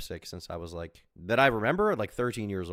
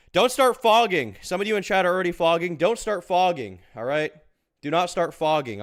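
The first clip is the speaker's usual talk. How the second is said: mock warning; 8 semitones higher, 6 dB louder than usual. Warning chat not to fog.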